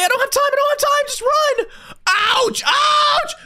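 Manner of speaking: high frantic yelps; being chased. Real, not acted. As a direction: yelp in panic while fleeing. Monster catches him.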